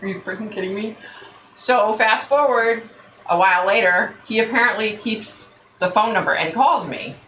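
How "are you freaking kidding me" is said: stunned. Retold or imitated. Disbelief after the call.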